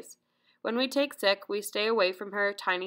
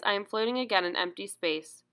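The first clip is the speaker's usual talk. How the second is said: plain reading voice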